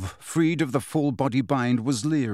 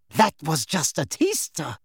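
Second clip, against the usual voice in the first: Bellatrix's voice. A narrator's reading. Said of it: "said Bellatrix".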